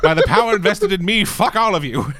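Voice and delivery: loud, high-pitched hammed-up wedding pronouncement; villainous mock officiant. Bad guy marrying people against their will, villainous mock officiant. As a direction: declare it like a rogue wedding officiant. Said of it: "bad guy N P C who just runs around marrying people".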